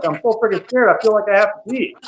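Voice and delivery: put-on half-joking scared kid voice. Recalling his first race, imitating his own mild panic. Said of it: "I'm like".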